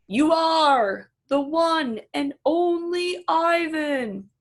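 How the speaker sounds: slow, loud, high call. Acted. Bob calling back to Ivan, slow proud call. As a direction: call it out proudly across the distance. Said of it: "he calls".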